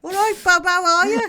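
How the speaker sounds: loud, hammed-up Brummie greeting; Birmingham accent. [name] greeting her co-host in a playful Birmingham accent. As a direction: belt it out in a broad Birmingham accent. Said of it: "doesn't sound like she's from Birmingham".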